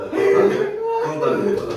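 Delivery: slow, very high squeal through laughter; high laughing squeal. Real, not acted.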